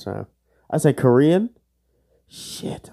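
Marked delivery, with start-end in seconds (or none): 2.3-2.9 whispered curse. Real, not acted. Quiz answer wrong.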